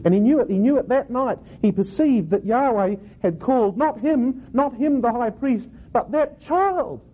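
put-on high, impassioned build to "child"; slow, weighty contrasts, landing high and drawn out on "child"; dramatic retelling. Shown as a demonstration. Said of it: "Can't you see the drama".

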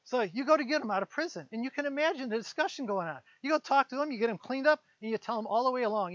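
ordinary delivery

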